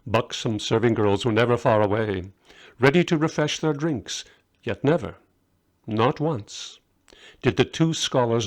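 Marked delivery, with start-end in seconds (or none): none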